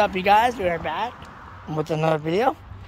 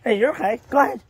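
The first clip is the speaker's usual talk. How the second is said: raised, encouraging call; 6 semitones higher, 5 dB louder, faster than usual. Real, not acted. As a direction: call him on cheerfully. Encouraging dog from a distance.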